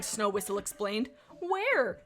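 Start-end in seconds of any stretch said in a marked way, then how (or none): 1.4-2.0 high squeaky kitten question; chittered. Acted. Tabby kit asking where they go, high chitter. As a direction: pop it up high and small. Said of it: "chittered a tabby she kit".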